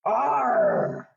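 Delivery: high, drawn-out pirate arr; pirate noise. Shown as a demonstration. Showing children what a pirate goes like, pirate arr. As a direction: growl out a playful pirate cry. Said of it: "what noise a pirate makes".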